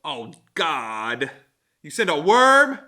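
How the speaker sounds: can't believe God did that; surprised. Real, not acted. Reacting to the reading.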